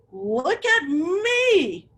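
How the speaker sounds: slow, drawn-out high call; crying out. A narrator's reading. Teacher voices the penguin showing off in the club, slow and high. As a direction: stretch it out proudly. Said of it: "little penguin cried".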